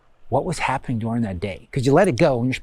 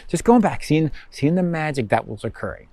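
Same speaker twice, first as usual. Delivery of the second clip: ordinary talk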